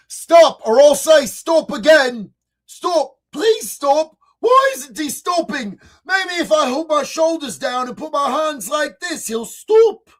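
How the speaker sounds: mock British accent, pitched high with pleading exasperation; British threat. Streamer mocking a feeble British threat, hammed-up British accent. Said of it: "British threat".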